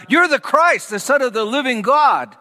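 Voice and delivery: high, eager outburst, imitating Peter; blurted out. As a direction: burst out with it as Peter would. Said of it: "Peter blurted out".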